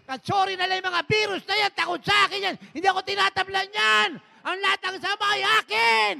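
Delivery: high, fervent shouted declaration of victory; preacher demonstrating triumphant confession; ecstatic proclamation. Shown as a demonstration. Preacher showing congregation how to declare, ecstatic shouting.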